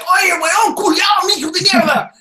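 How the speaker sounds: high, loud slurring curse in Spanish; angry drunk. Retold or imitated. Chilean drunks cursing at missionaries, angry shout. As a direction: bellow it like a drunk. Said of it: "angry drunks that would be like".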